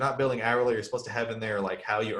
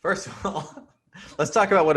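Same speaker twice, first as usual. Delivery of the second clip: laughing; 7 semitones higher, 5 dB louder than usual. Real, not acted. Laughs after accent joke.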